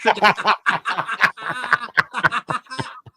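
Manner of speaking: high burst of laughter. Real, not acted. Laughing at his freeze.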